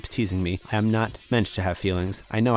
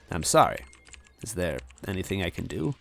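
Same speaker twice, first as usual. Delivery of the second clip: usual speaking voice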